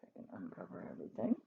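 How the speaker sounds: soft unvoiced whisper; whispered aside. Real, not acted. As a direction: murmur it to yourself. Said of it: "aside to viewer".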